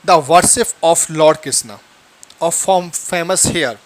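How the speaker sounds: slow, word-by-word read; slow teacher's dictation. Shown as a demonstration. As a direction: read it slowly so students can follow. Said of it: "teacher reads English sentence".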